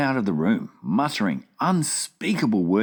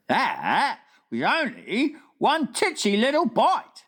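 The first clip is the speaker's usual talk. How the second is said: BFG voice. Acted. Giant asking the Queen at breakfast, wondering. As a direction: puzzle it out like a big gentle giant.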